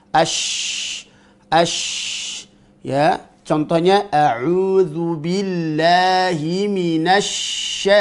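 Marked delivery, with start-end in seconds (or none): 0.1-2.5 ASH with a long, airy hushing SH; flowing shin. Shown as a demonstration. Teacher showing airflow on shin, drawn-out hissed SH in ASH. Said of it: "muncullah sifat namanya ar-rakhawa". 4.1-7.9 slow chant, holding the SH of MINASY; flowing shin in recitation. Shown as a demonstration. Teacher giving an example of shin, slow recitation with SH drawn out. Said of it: "muncullah sifat namanya ar-rakhawa".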